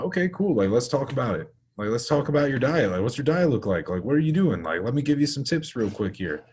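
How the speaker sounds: upbeat fast-talking gym-bro enthusiasm; eager reply. Retold or imitated. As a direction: jump at the chance to talk diet. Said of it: "and I'm like".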